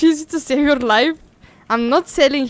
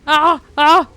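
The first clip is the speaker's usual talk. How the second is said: high, loud panicked screams; real screams; 4 semitones higher, 9 dB louder than usual. Real, not acted. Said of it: "Bro, stop screaming".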